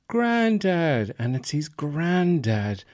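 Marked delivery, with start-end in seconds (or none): 0.1-1.1 put-on 1 delighted kid shout. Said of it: "and he goes".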